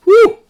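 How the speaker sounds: high loud woo against the heat; pained whoop. Real, not acted. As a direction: yelp it out from the burn. Spicy food burning.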